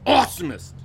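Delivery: monster truck ad. Acted. Bill announces the new word.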